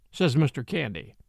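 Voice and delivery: plain reading voice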